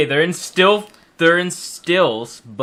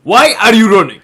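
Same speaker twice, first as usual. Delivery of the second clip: high, loud mock shout; silly put-on voice; 9 semitones higher, 14 dB louder than usual. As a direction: yell it high in a goofy put-on voice. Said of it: "that's not the fucking voice you're doing".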